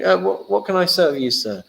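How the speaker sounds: a hammed-up courteous server; polite waiter. Diners serving each other in heaven.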